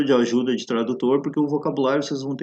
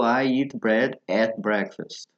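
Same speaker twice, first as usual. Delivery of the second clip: slow model phrase; much slower than usual. Shown as a demonstration. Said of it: "o fulano falou".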